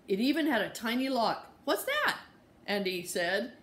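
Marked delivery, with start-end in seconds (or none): 1.6-2.2 put-on very high curious child voice; little kid asking. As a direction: ask like a nosy toddler. Toddler asking question.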